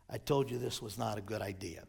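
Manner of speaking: low, quick undertone; muttered aside. Acted. One killer to the other, low rueful aside. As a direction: mutter it low to your accomplice.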